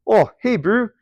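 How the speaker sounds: high Kiwi greeting, "bro" as "brew"; New Zealand accent. Shown as a demonstration. Teacher greeting a mate, in New Zealand accent. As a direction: call to a mate in broad Kiwi. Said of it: "that's me saying".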